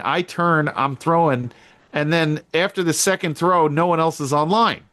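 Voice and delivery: ordinary delivery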